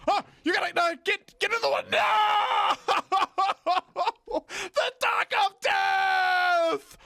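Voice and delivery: high, loud frantic yells and laugh; loud frantic laughing shout; panicked yelling. Real, not acted. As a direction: scramble yelling in alarm. Panic in game.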